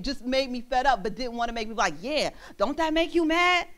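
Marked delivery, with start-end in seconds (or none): 2.0-3.7 high-pitched mocking goad; goading mimicry. Acting out a bad example, mockingly goading. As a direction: mimic someone stirring up anger.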